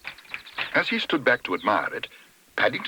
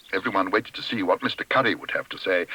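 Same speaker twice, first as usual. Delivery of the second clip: usual speaking voice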